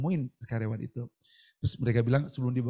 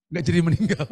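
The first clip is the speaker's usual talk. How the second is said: sambil tertawa; 3 semitones higher, 6 dB louder than usual. Real, not acted. Tertawa atas mukjizat.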